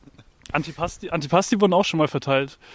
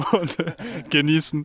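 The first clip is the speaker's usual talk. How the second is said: laughing; 3 semitones higher than usual. Real, not acted. Lachend beim Erzählen.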